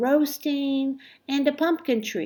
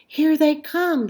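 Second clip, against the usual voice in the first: higher and louder, calling out with joy; excited shout; 6 semitones higher, 6 dB louder than usual. A narrator's reading. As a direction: call it out as the lights appear. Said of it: "she shouted".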